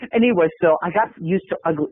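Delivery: ordinary delivery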